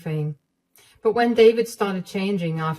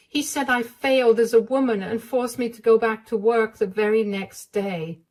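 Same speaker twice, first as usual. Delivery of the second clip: higher, pleading voice; higher, hesitant confiding voice; frightened young wife; 6 semitones higher than usual. Acted. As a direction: beg her not to be angry. Wife recounting abuse.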